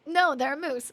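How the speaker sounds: ordinary talk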